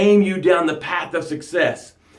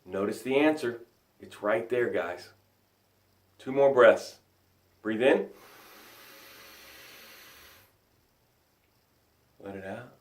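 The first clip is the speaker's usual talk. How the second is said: guided breathing; 7 semitones lower, 14 dB quieter than usual. Acted. Coach leading the tribe through four breaths, soft guided cues. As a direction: guide the room calmly through each breath.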